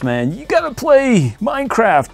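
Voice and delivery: high, drawn-out mocking voice; slow, high-pitched put-on nagging; mock commenter. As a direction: imitate a pestering online commenter. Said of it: "somebody in the comments".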